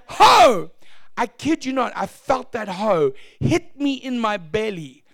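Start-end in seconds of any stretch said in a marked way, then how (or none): 0.1-0.7 high, loud burst of "Ho"; sharp loud shout. Retold or imitated. Lady shouting over preacher on the floor, high loud burst. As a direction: blast a single "Ho!". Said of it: "She just went".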